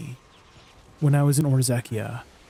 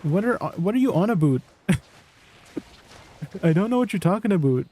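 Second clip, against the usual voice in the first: higher-pitched mock Canadian accent with laughter; 5 semitones higher than usual. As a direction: put on a Canadian "about". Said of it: "Not my Canadian roots coming out".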